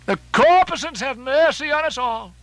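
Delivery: high, loud cry for mercy; awed cry. Acted. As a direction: call it out like a desperate prayer. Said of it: "cried Stubb again".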